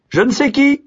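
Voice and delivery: appel très haut et sonore. A narrator's reading. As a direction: appeler le serviteur invisible. Le vieillard appelle. (le vieillard appelle son serviteur, voix haute)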